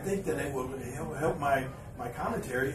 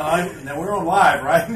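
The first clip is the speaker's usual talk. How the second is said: ordinary talk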